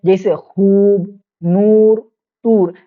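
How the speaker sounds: long, held oo in खूब, नूर, तूर; खूब, नूर, तूर each said with a lengthened oo; drawn-out vowel. Shown as a demonstration. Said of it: "खींच कर पढ़ते हैं".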